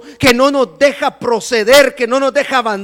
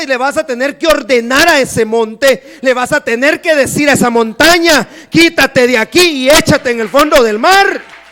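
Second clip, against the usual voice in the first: louder, driving sermon delivery; full-force preaching; 7 dB louder than usual. Acted. Preacher urges the church to command the mountain, loud. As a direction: preach it out with conviction.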